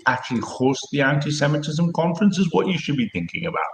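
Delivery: ordinary delivery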